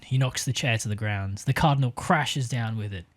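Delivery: ordinary talk, nothing marked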